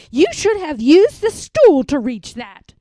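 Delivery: loud scolding shout; yelling. A narrator's reading. Mom yelling at Felix after the spill. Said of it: "yelled his mom".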